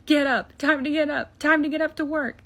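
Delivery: excited mock yelling to get someone up; rousing call. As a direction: call it out urgently, each repeat pushed. Peter bursts in to wake Tony.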